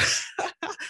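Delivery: high unvoiced laugh of relief; relieved breathy laugh. Real, not acted. Relieved after the danger.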